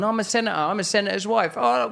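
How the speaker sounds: high, breathless mock panic at the crowd; overwhelmed frantic voice. To Shauna, acting out his overstimulated self, high frantic bewilderment. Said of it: "I talked about I'm overstimulated".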